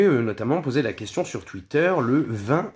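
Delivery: ordinary talk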